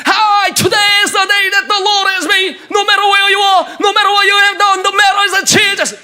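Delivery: loud, slow, high preacher's cry; shouted English preaching. Retold or imitated. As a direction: preach it out to thousands at full voice.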